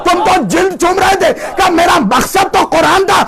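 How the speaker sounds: fiery preaching. Acted. Preacher rousing his congregation with a story, shouting.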